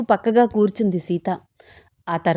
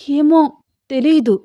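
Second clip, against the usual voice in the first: high, louder anxious reply; frightened wife; 7 semitones higher, 5 dB louder than usual. Acted. Sita cannot say who is outside, frightened. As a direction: answer in worried confusion. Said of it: "నాకు భయమేస్తోంది".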